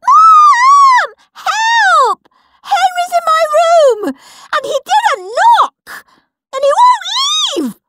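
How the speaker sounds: very high, loud tattletale wail; screaming for Mum. Acted. Peter yelling for Mum while Henry grabs, screaming. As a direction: shriek for help upstairs.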